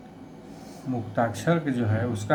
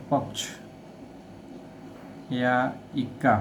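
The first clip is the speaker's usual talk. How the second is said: पक्ष and इक्का with the first vowel stretched taut before the cluster; tense the first vowel of पक्ष and इक्का; slightly slow, weight on the first syllable; stress before the cluster; 4 dB quieter than usual. Shown as a demonstration. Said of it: "पहले वर्ण का स्वर थोड़ा टन जाता है".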